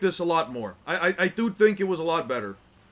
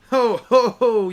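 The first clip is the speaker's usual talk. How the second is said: loud, higher hammed-up ho ho ho; hearty ho-ho laugh; 7 semitones higher, 12 dB louder, slower than usual. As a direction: boom out a jolly character laugh. Character's greeting laugh, read aloud.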